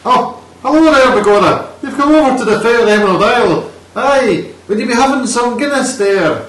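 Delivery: very high, loud stage-Irish voice; hammed-up Irish accent. Shown as a demonstration. Podcaster showing off for co-host, hammed-up Irish accent. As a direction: put on a shouty, over-the-top Irish brogue. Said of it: "I can do an Irish accent".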